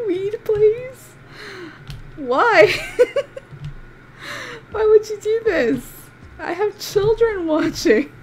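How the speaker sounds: high, loud, laughing protest; mock scandalised through laughter; shocked giggling complaint to the game; laughing outrage. Real, not acted.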